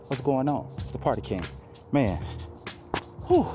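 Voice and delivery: ordinary talk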